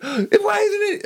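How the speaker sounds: very high, wailing hysterical impression; mock panic. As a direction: imitate a panicked brother in a squealing falsetto. Acts out brother panicking over grandma.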